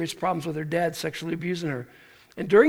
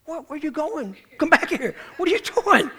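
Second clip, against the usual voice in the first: much higher, in disbelief at someone walking away; alarmed call; 9 semitones higher than usual. Retold or imitated. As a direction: call after him, startled. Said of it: "uh, like".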